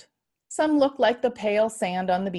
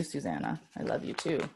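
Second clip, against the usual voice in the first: soft whisper; 7 semitones lower, 11 dB quieter than usual. Real, not acted. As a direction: hush it, barely voiced. Father to sleeping child.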